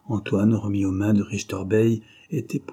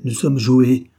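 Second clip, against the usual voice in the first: louder cry of alarm; alarmed exclamation; 3 semitones higher, 8 dB louder, slower than usual. Acted. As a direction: blurt the betrayal. Husband realises the trap.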